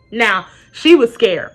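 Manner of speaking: ordinary delivery, nothing marked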